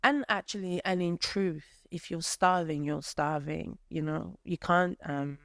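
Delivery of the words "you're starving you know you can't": ordinary delivery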